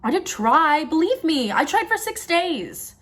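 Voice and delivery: high-pitched, insistent self-defence; high, drawn-out protest at the end; pleading protest. Acted. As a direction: plead to be believed, pitch climbing. Pleads that she really tried.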